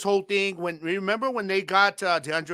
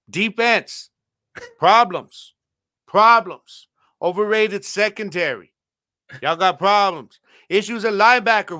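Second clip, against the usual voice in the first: louder, punchy emphasis; emphatic listing; 3 semitones higher, 6 dB louder, slower than usual. Real, not acted. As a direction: hammer each problem home. Taunting rival team.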